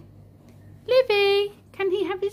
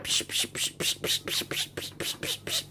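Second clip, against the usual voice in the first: milking squirts; 10 dB quieter than usual. Pretend. Mum imitating milking sound.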